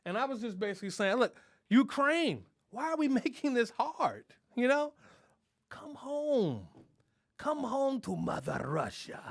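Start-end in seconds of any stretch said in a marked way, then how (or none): 1.2-9.2 a hint of a coaxing Putin, slow and higher; lightly voiced Putin. Comic as Putin to Ukraine, lightly voiced coaxing. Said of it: "I can't talk in an accent".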